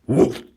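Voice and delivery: loud single woof; one loud deep woof; big dog woof. Acted. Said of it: "said Hercules Morse".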